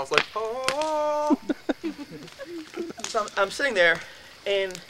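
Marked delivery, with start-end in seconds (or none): 0.4-1.4 satisfied sigh. Retold or imitated. Candy satisfaction.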